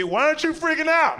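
lightly voiced puzzled passenger; high mock puzzled passenger. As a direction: ask it in a high incredulous voice. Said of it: "They gonna look at me".

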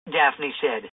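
plain reading voice

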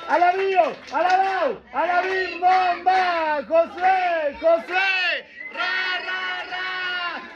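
punchy chanted hurrah; party cheer. Real, not acted. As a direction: lead the crowd in a birthday cheer. Leading a birthday cheer.

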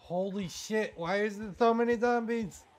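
high, loud alarmed shout; panicked yell. Real, not acted. Gamer spotting a zombie horde, shouting in panic. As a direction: yell in real shock at the horde.

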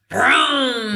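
growled engine vroom; car vroom. Shown as a demonstration. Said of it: "imagine cars vrooming".

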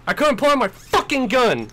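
loud high angry shouting; frustrated yelling. Real, not acted. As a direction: yell it in frustration. Frustrated, can't pull his gun.

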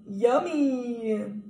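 ordinary delivery

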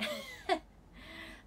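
a sudden airy giggle, high up; high breathy laugh. Real, not acted. Bursts out laughing at own photo.

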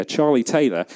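normal talking voice

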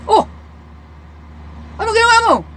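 high startled yelp of a question; surprised policeman. Retold or imitated. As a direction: react like a shocked policeman. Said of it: "Nagulat yung pulis".

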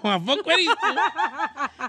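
very slow, high, laughing disbelief; mock disbelief. The father discovering it's his daughter, slow laughing disbelief. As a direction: stretch it out like a stunned father, laughing. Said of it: "Así como".

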